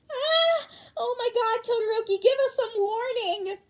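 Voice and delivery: shrieking fan. Acted. Classmate shocked by Todoroki's photo, shrieking. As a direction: squeal in flustered shock.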